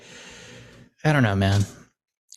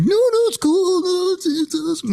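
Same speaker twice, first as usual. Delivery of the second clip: Fallon reassuring; 11 semitones higher than usual. Retold or imitated. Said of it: "He's like".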